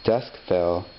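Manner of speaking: plain reading voice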